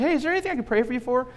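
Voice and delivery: high, quick and cheerful; bright eager offer. Retold or imitated. Pastor offering to pray for a stranger, bright and eager.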